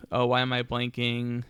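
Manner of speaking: usual speaking voice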